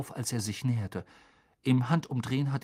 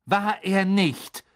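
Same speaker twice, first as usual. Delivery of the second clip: sudden defiance; 5 semitones higher, 4 dB louder, much slower than usual. A narrator's reading. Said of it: "sagte Harry plötzlich".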